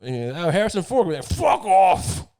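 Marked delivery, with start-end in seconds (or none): 0.5-1.1 eager interviewer greeting. Retold or imitated. Interviewer greeting Ford in Bruno. 1.2-2.3 a mock angry bark, higher and louder; imitated yell. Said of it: "he's like".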